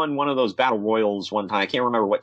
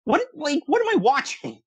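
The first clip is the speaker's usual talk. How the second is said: stunned, what-is-this squeal; baffled. Retold or imitated. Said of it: "I'm like".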